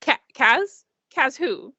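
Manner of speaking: put-on an old droid double-checking a name; puzzled droid. Old droid clerk asking the passenger's surname, puzzled. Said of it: "pauses for a second and goes".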